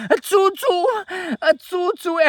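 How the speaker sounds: very high squeaky mock cry; high falsetto mock plea; mock Polish cry. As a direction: squeal it like a cartoon victim. Mocking the Polish word for help.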